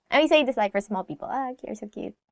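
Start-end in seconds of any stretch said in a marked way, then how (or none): 1.3-2.2 soft cooing. Shown as a demonstration. Cooing at small people.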